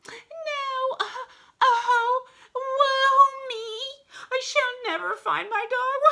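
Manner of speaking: very high, hammed-up fake sob; fake crying. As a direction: wail like a bad actress in disguise. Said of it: "she pretends to start crying".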